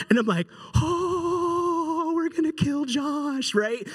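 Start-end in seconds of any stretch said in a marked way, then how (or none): 0.8-3.6 high, drawn-out wail of dread; stretched high-pitched panicked realization; mock panic. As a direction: act out losing your mind. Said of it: "I'm just totally losing my mind".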